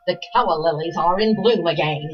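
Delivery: lofty, theatrical Hepburn voice; grand old stage diva line; Katharine Hepburn impression. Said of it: "I used to be able to do Captain Hepburn".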